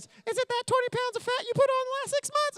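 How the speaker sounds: high, mocking wife voice; wife's teasing jab. Wife teasing husband about his weight, high mocking voice. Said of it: "And then she goes".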